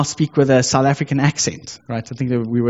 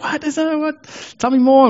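much higher, eager and quick; eager excitement; 13 semitones higher than usual. Retold or imitated. Asking the coworker eagerly about his faith, excited. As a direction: beg to hear more.

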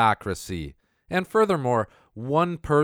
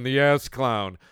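ordinary delivery

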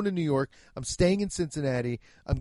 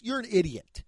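usual speaking voice

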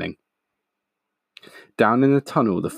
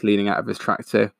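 usual speaking voice

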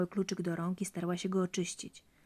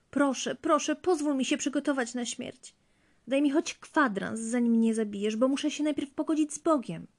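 high, loud, desperate pleading; begging for life; 7 semitones higher, 4 dB louder than usual. A narrator's reading. Wife begs Bluebeard for a quarter hour, desperate pleading. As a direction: plead urgently with raised pitch. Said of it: "Błagała o życie".

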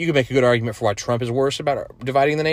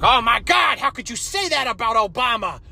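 high, shrill-pitched mock gasp of outrage; much higher, hammed-up offended voice; mocking outraged fan; 11 semitones higher than usual. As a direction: parody a scandalised Obama fan. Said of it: "I know some of you are saying".

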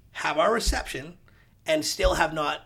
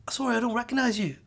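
higher, imitated polite British man; British accent; 8 semitones higher, faster than usual. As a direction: mimic a British developer politely brushing someone off. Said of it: "British accent".

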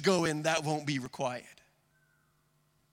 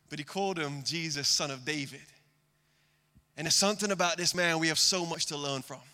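ordinary delivery

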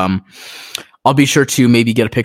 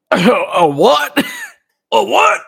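stoned; 13 semitones higher than usual. Retold or imitated. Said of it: "I was like".

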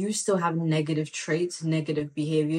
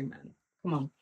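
ordinary talk, nothing marked

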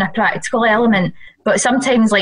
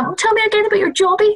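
high-pitched, quick, tickled prompting; mock-innocent teasing; 12 semitones higher, faster than usual. Tickled Scot asking Hull friends about "jobby", mock-innocent teasing. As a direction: egg them on in a high, playful voice. Teasing people who misunderstood slang.

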